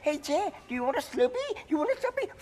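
put-on high sing-song Indian shopkeeper accent; mocking Indian convenience-clerk accent. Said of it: "Do I talk like a turban guy? Do I say".

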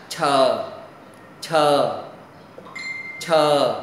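each "chờ" released with a burst of air, falling pitch; aspirated sound. Shown as a demonstration. Teacher has students repeat the consonant, aspirated sound. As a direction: drill "chờ" with breath pushed out on the "ch". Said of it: "âm hơi gió bật hơi".

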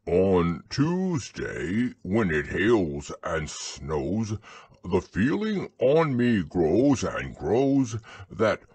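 very slow, measured bear recital; ponderous, deliberate verse reading; poem recitation. Acted. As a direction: recite it solemnly, drawing out each line. Pooh recites his poem.

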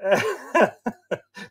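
high chuckle at his own pun; corny dad laugh. Real, not acted. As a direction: laugh at your own joke. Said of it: "I'm laughing at my own jokes".

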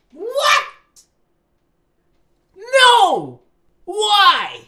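very high, loud one-word cries; shocked shrieks. Real, not acted. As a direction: yell each word in disbelief. Shocked reaction to the text.

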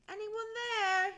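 loud, high, drawn-out call; long searching holler. A narrator's reading. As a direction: call far into the woods. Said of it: "she called out".

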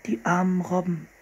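Anne's pitying voice. Acted. Anne pities the seals.

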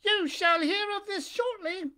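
high, slow warning from a puppet; high puppet voice, a touch threatening. Acted. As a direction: promise trouble, slowly and high. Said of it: "shook his head threateningly".

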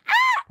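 high excited shriek; fangirl squeal. Real, not acted. Spotting a favourite book's reference, fangirl squeal. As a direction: squeal with delight.

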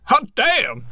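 high, sharp exclamation; Samuel L. Jackson impression. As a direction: blurt it out in surprise. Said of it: "Samuel Jackson looked up ... and said".

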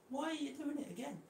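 high-pitched exasperated whine; squeaky-high pleading with a misbehaving pet. Real, not acted. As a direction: voice shoots way up in exasperated protest. Exasperated with the circling snake.

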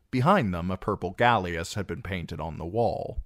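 usual speaking voice

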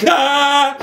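high piercing scream; mum's scream. A narrator's reading. Mum sees the spider is loose: high scream. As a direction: let out a shrill scream of panic. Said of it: "do more shrieking".

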